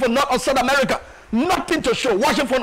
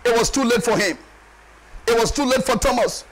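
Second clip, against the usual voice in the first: ordinary talk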